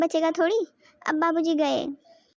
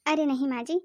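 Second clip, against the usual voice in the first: ordinary delivery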